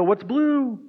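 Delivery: comic confused blind man; puzzled Bart. Acted. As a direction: deliver the punchline puzzled. Blind man's punchline question.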